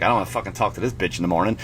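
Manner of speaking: low, quiet, quick aside; mock muttered thought. Man sneaking out after a one-night stand, low quiet mutter.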